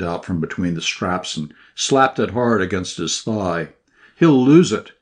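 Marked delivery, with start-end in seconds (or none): 4.2-4.9 louder, higher near-shout on He'll lose it; almost shout it, losing his temper. A narrator's reading. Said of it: "he almost shouted".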